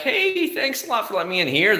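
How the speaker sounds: very high, chirpy mock thanks; sing-song friendly gratitude, hammed up. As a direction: imitate an overly grateful driver. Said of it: "everybody's very friendly".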